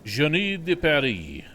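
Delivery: loud, slow, high French flourish; hammed-up French. Streamer tries out French from school, hammed-up French.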